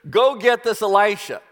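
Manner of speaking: a touch of acted rage in a brisk order; enraged. Raging king orders his men to seize a prophet, mildly enraged. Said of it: "Well, in a rage now".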